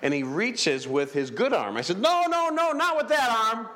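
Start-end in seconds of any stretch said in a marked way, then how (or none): 2.0-3.7 urgent no. Retold or imitated. Telling paralyzed man which arm.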